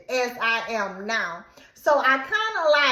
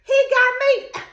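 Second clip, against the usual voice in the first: higher and louder, outraged; furious outburst; 11 semitones higher, 4 dB louder than usual. Retold or imitated. Woman realizing boyfriend cheated, loud and high. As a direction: blurt out the betrayal, rising. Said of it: "I like".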